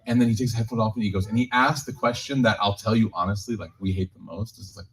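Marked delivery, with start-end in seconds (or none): none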